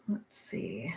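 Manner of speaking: whispered to herself. Real, not acted. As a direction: whisper while searching. Looking something up.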